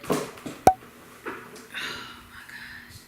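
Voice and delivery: hushed, breathy reveal with long pauses; awed whisper. Acted. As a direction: whisper it in starstruck awe.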